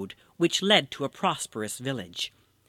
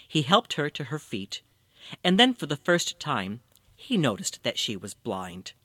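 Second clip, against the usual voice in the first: usual speaking voice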